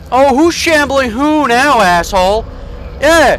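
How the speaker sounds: loud, slow gloating yell; triumphant shouting. Real, not acted. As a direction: gloat over the beaten monster. Beats the monster.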